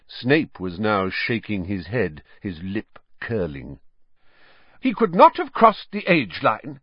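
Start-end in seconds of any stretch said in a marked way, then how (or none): 4.8-6.8 much higher, louder sharp retort, stress on not. A narrator's reading. McGonagall defending Harry to the other heads, sharply. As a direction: cut the doubt off crisply. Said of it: "said Professor McGonagall sharply".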